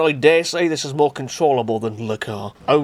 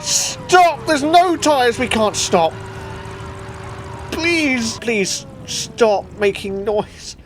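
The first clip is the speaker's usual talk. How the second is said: pleading shouts; 9 semitones higher, much slower than usual. Real, not acted. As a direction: yell at the runaway car. Car won't stop, no tyres.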